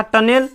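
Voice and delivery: टनेल as "tun-ell"; wrong reading टनेल, ending "-nel"; mispronunciation demo. Shown as a demonstration. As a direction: say टनेल with a clear "e" before l. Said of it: "तो ये गलती हो जाएगा".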